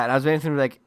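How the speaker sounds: ordinary delivery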